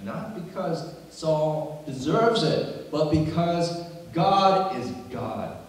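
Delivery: slow, high, loud proclamation; emphatic preaching. Acted. Preacher explaining David's respect for Saul, emphatic preaching. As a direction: drive each phrase home slowly and forcefully.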